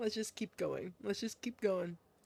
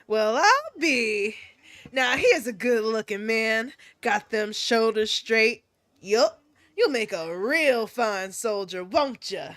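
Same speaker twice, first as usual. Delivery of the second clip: admiring folksy woman; 3 semitones lower than usual. Acted. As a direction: size up a fine young soldier approvingly. Voices Missus Kyozuka admiring a recruit.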